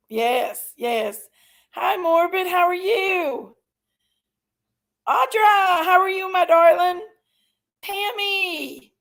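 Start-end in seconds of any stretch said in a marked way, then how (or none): 1.7-8.9 tearful greeting. Real, not acted. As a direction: voice breaking with tears, pitched up. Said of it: "I'm still a little crying here".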